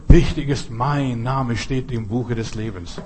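ordinary talk, nothing marked

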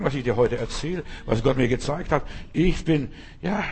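ordinary delivery

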